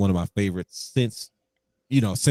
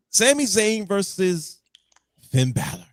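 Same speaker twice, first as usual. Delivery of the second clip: announcer; 9 semitones higher, slower than usual. Acted. Announcing the match.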